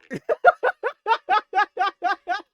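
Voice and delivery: high, loud burst of laughter. Real, not acted. Laughing hard at a friend's joke, a high loud burst. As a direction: crack up at the joke.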